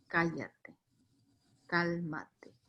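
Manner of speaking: soft, low, measured command; hushed, firm words with pauses between; quiet assertiveness. Acted. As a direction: order calm without raising the voice. Repeats Jesus' command as calm assertiveness.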